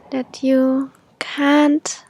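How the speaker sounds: usual speaking voice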